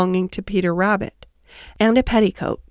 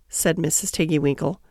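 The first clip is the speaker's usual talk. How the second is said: ordinary delivery, nothing marked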